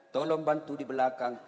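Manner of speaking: low mock recitation; imam improvising. Stuck imam asking those behind for help, low mock chant. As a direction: intone the plea low, as if part of the surah. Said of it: "Ini Pak Imam kreatif".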